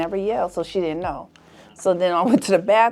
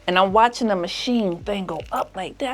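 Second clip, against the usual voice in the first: normal talking voice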